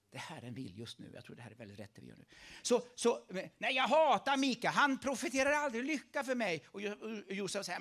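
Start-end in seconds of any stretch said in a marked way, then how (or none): 2.6-6.6 mock outburst. Retelling Ahab hating Mika.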